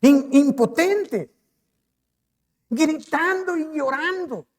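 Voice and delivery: anguished preaching. Acted. Preacher dwelling on David's grief.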